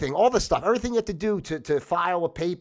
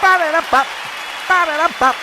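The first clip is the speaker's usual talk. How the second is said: high, loud mouthed jingle sting; ba-da-da-bop jingle; 11 semitones higher, 7 dB louder than usual. Pretend. Host breaking up the rant, high ba-da-da-bop jingle. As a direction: punch out a high scat sting.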